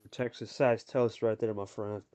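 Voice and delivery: ordinary talk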